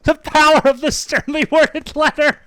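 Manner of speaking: high, amused repeat of the phrase; amused echo. Retold or imitated. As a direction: savor the phrase with a grin. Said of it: "I don't know how he comes up with these".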